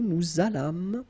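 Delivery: "Nous allâmes" linked with a z, slowly; liaison. Shown as a demonstration. Teacher repeating the link for learners, liaison. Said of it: "make this little link".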